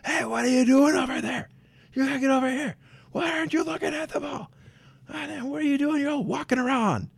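high, far-off mock coach bark across the field; a parent coach hollering at kids; yelling coach. Mock yelling coach at young players. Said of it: "It's like, "Jeez, man, settle down".

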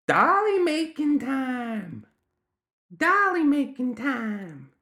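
put-on piercingly high, drawn-out child squeal; forceful gremlin child. A narrator's reading. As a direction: insist on it, very high and slow. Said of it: "said again forcefully".

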